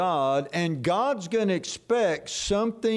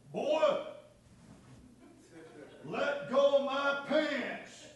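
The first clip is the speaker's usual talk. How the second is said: high, soft, slow strained father; a father pleading under his breath to let go; a hushed, pinched dad trying not to make a scene; dad's strained plea; 9 semitones higher, 7 dB quieter, slower than usual. Retold or imitated. Dad voiced in mall story.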